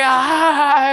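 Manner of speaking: high, rising questioning "ah"; questioning laugh tail. Retold or imitated. Comedian imitating Atlanta laughs that trail off, questioning. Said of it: "It's like an inquisitive end at the end".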